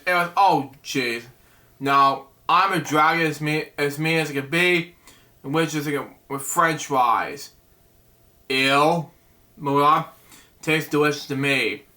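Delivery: ordinary delivery, nothing marked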